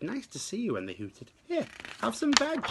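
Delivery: very high, hooty friendly calls; hooting duckbills. A narrator's reading. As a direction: coo it like gentle duckbills.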